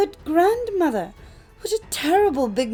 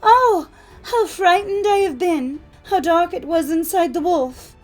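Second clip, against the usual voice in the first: put-on high, loud distressed child; loud high wail of relief and fright; crying out frightened; 11 semitones higher, 8 dB louder than usual. A narrator's reading. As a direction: cry out shaken, like a rescued little girl.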